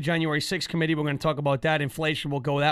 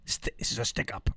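whispered, softer robber line; whispered stick-up; 7 semitones lower, 8 dB quieter than usual. Retold or imitated. Host mocking the Governor's video as a whispered holdup. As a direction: hiss it like a hushed holdup. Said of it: "looks like you're robbing a bank".

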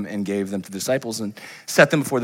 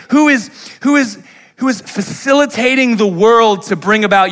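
loud, much higher preaching build; preaching at full force; 11 semitones higher, 9 dB louder than usual. Real, not acted. Preacher rousing congregation, loud and soaring. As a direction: lift the room with a rising preach.

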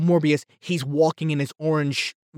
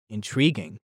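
mock brooding Morbius; 8 semitones lower, 6 dB quieter than usual. Mocking Morbius's one-word reply, low and soft. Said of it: "what is Morbius's reaction goes?".

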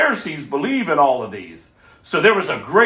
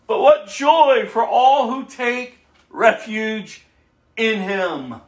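higher-pitched, declaimed psalm; dramatic scripture reading; 6 semitones higher than usual. A narrator's reading. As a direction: read the psalm out like a proclamation.

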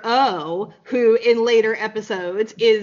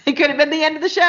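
fast, laughing through the words; 6 semitones higher, 5 dB louder, much faster than usual. Real, not acted. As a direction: giggle while you say it. Laughing at own joke.

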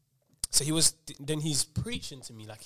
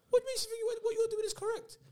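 much higher, laughing mock voice; mocking preacher. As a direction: squeak it back at him mockingly.

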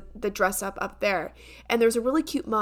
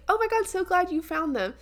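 higher, cheery imitation of mom; light mom voice, pitched up; pleased mom; 7 semitones higher than usual. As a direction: sound glad like a sweet mom. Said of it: "she was like".

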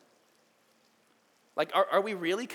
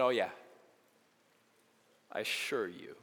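gentle assurance; 8 semitones lower, 6 dB quieter than usual. Acted. Jesus reassures thief.

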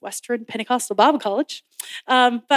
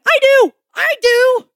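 much higher, louder and slower, bursting; an excited kid volunteering at the front; eager childlike shout; 11 semitones higher, 8 dB louder, slower than usual. Retold or imitated. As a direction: cry it out like a thrilled child. Ran down aisle, eager child.

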